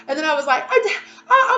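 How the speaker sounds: high, loud laughter through the words; laughing. Real, not acted. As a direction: break up laughing mid-sentence. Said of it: "laugh cry".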